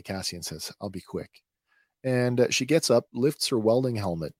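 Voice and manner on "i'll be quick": mildly sheepish, softer retold line. Cassian promises Bix he won't take long, retold as sheepish. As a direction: deliver it small and quiet, mock sheepish.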